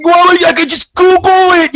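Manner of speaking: very high, squeaky mock kid. Host imitating kids who never bought CDs, mocking high voice. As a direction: jump the pitch right up to parody kids. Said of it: "these kids go".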